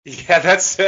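high, loud laughing agreement. Real, not acted. Co-host reacting to an Office quote, laughing. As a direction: crack up while agreeing.

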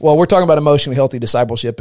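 punchy podcast intro; energetic topic launch. Real, not acted.

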